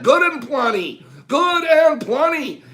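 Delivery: excited. Real, not acted. Triumphant reveal of a candy.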